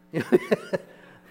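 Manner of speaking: squeaky laugh at own joke; high burst of laughter. Real, not acted.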